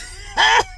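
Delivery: a burst of shrill, loud laughter; high shrieking laugh. Pretend. Angry viewer bursting into a loud high laugh. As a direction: cackle out loud at the screen.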